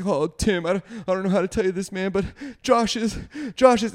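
high, rushed, faltering bad-news voice; mock distressed. Caller to best friend's dad at night, mock distressed. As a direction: imitate breaking terrible news, climbing in pitch.

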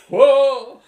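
hammed-up cartoon Texan shout; loud Hank Hill yelp. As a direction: yelp like a startled Texas dad.

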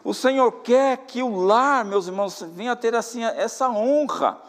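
loud, ringing sermon voice, stretched phrases; preaching at full force. Acted. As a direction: exhort the church, slowly and forcefully. Preacher closing his exhortation.

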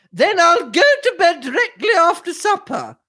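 put-on very high, dithering older lady; high-pitched imperious mistress, fussing; flustered lady of the house. A narrator's reading. Mistress answers her parlour maid, high and flustered.

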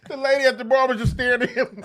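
usual speaking voice

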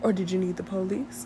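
soft, hushed mock operator; smooth dispatcher voice. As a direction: play a smooth emergency dispatcher, softly. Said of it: "hit you with a smooth voice".

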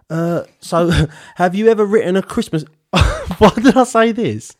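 high, helpless real laughter through the words; laughing. Real, not acted. Host embarrassed by what he wrote, laughing as he reads it. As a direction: crack up at your own question. Cracking up at his own question.

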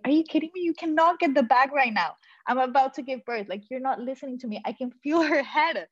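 to husband fetching bag, high exasperated urging. Retold or imitated. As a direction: tell him off, urgent and fed up. Urging husband mid labor.

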